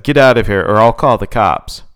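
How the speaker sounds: loud firm order; loud command. Acted. Sadie threatens the clown with the cops, loud. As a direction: shout him off with a threat. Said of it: "Sadie said".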